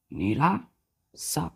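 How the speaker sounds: निराशा stretched very slowly; slow teaching demo. Shown as a demonstration. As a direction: linger on each syllable of निराशा. Teacher drawing out the word. (धीरे-धीरे निराशा)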